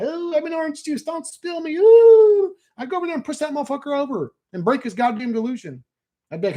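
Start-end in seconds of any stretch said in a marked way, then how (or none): 0.0-2.5 high mock whimper ending in a wail; mocking the tripper afraid he'll spill, a high whiny wail; orange juice guy. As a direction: ham up the panicked juice tripper. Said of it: "We don't see the orange juice guy".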